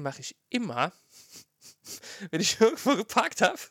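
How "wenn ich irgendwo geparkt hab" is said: laughing through the words; giggling while confessing. Real, not acted.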